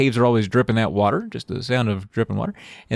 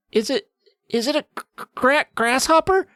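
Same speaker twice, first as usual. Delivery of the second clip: high, stammering, choked fright; 13 semitones higher than usual. Acted. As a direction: force the word out through fear. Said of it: "I choked out".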